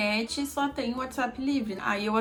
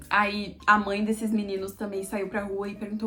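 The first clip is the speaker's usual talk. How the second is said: ordinary talk, nothing marked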